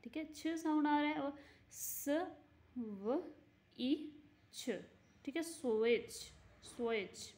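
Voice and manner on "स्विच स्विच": स्विच said as swich, t left silent; model pronunciation. Shown as a demonstration. Teacher models स्विच for fifth class, silent t. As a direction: repeat स्विच slowly, no t sound. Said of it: "ऐसे पढ़ रहे हैं ना हम लोग".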